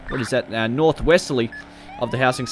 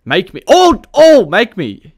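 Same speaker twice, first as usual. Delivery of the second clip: normal talking voice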